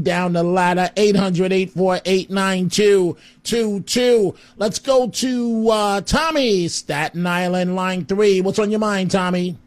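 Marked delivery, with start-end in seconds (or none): none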